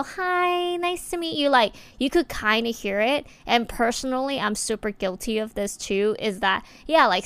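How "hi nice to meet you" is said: much higher, sugary hello; put-on high greeting. Imitating another woman meeting her, much higher cute greeting. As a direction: greet like someone pitching her voice up to sound cute. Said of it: "But when someone go".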